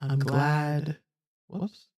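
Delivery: very low processed voice, slow welcome; pitched far down, drawn-out greeting; deep altered voice. Pretend.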